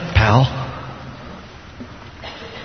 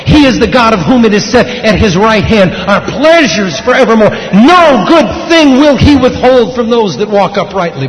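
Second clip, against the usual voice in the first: loud, raised-pitch scripture proclamation; full-force preaching; 7 semitones higher, 6 dB louder than usual. Real, not acted.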